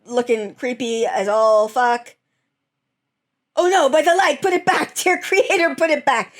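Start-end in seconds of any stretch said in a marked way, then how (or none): 3.6-6.3 mock horror. Voices a horrified character.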